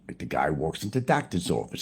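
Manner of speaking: normal talking voice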